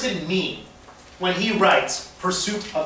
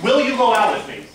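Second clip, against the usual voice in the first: "Will" punched at the start, the rest falling away; stress on WILL; 4 dB louder than usual. Shown as a demonstration. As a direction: lean hard on the first word "Will". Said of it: "on the emphasis on a different word".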